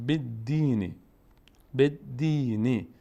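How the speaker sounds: بد دینی slowly, ی of دینی lengthened; teacher modelling بد دینی; slow recitation. Shown as a demonstration.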